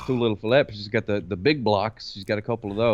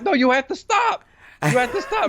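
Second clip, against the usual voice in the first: very high, comic pleading; mock alarmed parent; 12 semitones higher than usual. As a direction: plead with a baby in hammed-up alarm. Father stopping baby, retold.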